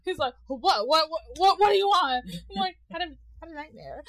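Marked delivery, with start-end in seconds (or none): 0.5-2.2 stammering, rising mock alarm; flabbergasted dad. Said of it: "just, like, flabbergasted". 2.9-4.0 small, soft, higher mock-pitiful voice; quick, sheepish little kid confession; meek child. Scared child pleading, acted out.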